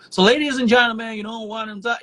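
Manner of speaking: high, loud announcer patter; typical MC hype voice. Retold or imitated. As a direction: mimic a generic event MC. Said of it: "you hear people who talk like".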